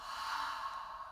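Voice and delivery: slow audible exhale through the mouth; long breath out. Shown as a demonstration. Teacher to children, demonstrating a slow exhale. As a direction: show the kids a big breath out. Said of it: "we'll all breathe out".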